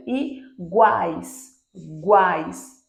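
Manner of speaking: "Iguais" drawn out, strong a in the middle; low, slow "Iguais" peaking on a; triphthong demo. Shown as a demonstration. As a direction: stretch the guais of "Iguais" into one glide. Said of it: "A vogal que é a mais forte".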